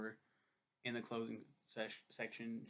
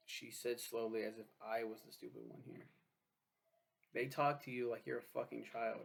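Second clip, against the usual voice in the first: ordinary talk, nothing marked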